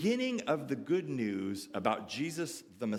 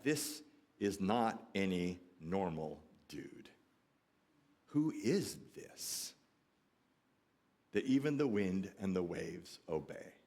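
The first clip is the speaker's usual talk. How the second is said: understated terror; 7 semitones lower, 4 dB quieter than usual. Acted. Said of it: "Their response was sheer terror".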